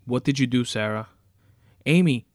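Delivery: loud accusing demand, then a cry; alarmed shouting. Acted. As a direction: call out desperately to wake her. Captive confronts captor, calls victim.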